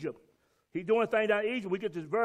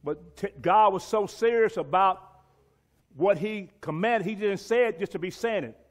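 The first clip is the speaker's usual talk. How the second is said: ordinary talk, nothing marked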